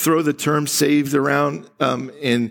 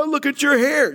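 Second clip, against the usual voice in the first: high mock fuss; 11 semitones higher, faster than usual. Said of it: "They go".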